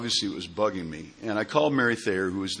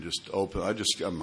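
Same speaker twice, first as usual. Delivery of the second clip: ordinary talk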